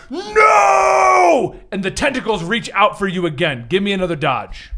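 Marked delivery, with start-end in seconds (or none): 0.1-1.6 high, loud, drawn-out no; villain's cry. Acted. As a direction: howl it at the heroes. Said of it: "Murder Machine calls out".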